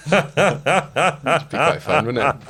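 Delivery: slow, giggling tease; laughing tease. Real, not acted. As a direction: drag it out through laughter. Teasing after blackmail joke.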